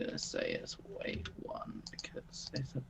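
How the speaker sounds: hushed whisper to yourself. Real, not acted. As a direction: whisper it under your breath. Quietly noting item weight aside.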